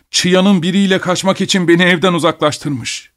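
high-pitched, bitter tirade; outraged cry. A narrator's reading. Betrayed husband curses his wife to the doctor, crying out in outrage. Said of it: "diye bağırdı".

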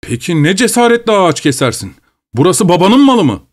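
angry challenge. A narrator's reading. Streit im Wald, Verwalter.

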